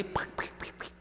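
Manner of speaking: tiny unvoiced taps, pic pic pic; knocking sound. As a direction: mimic knuckles tapping on a curtain. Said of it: "tocan así". (golpecitos imitados en la cortina)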